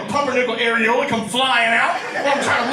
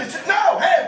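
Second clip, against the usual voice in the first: usual speaking voice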